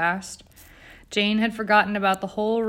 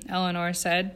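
ordinary delivery

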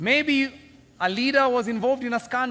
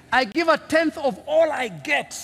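smug Pharisee; 6 semitones higher, 5 dB louder, slower than usual. Retold or imitated. Pharisee boasting in quoted prayer.

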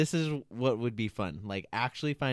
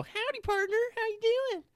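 much higher, over-the-top cowboy twang; cowboy greeting; faster than usual. Shown as a demonstration. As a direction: ham up a cartoon cowboy. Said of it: "there's the only way to say it".

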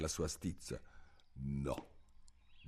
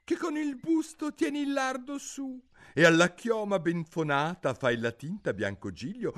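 put-on much higher teasing child; cheeky son; 14 semitones higher, 4 dB louder than usual. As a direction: tease your old father. Il figlio recita la poesia. (figlio impertinente, voce alta)

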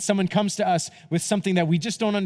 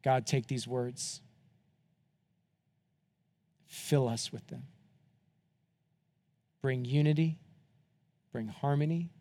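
closing prayer; 4 semitones lower, 8 dB quieter than usual. Acted. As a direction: pray gently for the whole room.

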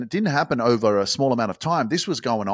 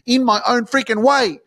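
much higher and louder, pumped-up cheering; excited; 8 semitones higher, 7 dB louder than usual. Pretend. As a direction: yell it out like a fired-up fan, hands in the air. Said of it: "put your hands in the air and go".

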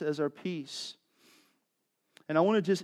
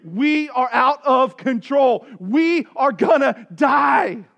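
slow, louder, building to a shout; desperate plea; 8 semitones higher, 11 dB louder, slower than usual. Pretend. Disciples pleading with Jesus in the storm, desperate building cry. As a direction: beg slower and louder until you cry out. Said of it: "be vulnerable and say".

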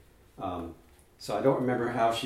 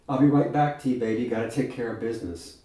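put-on sassy young woman; 2 semitones higher, 7 dB louder than usual. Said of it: "she says".

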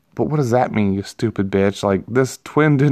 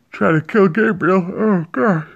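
words stretched by a yawn; 8 semitones higher than usual. Real, not acted. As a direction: talk through a yawn. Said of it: "I'm yawning".

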